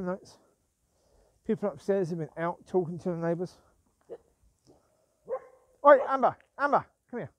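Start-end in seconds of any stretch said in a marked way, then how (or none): 5.8-7.3 loud, higher sharp call; calling the dog. Real, not acted. Owner calling Amber back, sharp loud call. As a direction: snap her attention back.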